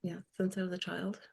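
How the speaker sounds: very soft, rising at the end; softly dropped-away answer; too quiet to hear. Real, not acted. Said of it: "Pardon me?".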